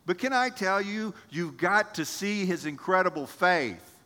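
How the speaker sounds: ordinary delivery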